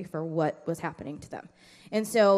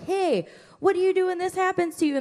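mild mock teacher voice; 10 semitones higher, 3 dB louder than usual. Voicing a puppet-show prompt to kids.

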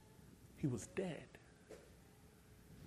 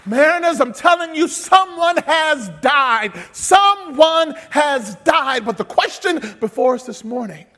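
slow, loud, higher preaching; preaching at full force; 10 semitones higher, 14 dB louder, slower than usual. Acted. Pastor exhorting his church, preaching at full force. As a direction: build the sermon to a shouting climax.

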